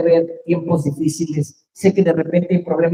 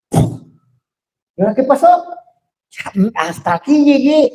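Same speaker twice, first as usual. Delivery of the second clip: higher, louder, slow worn-out spouse; fed-up spouse; 8 semitones higher, 4 dB louder, slower than usual. Pretend. Spouse after fifty years of marriage giving up, exasperated. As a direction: voice the despair of a tired spouse.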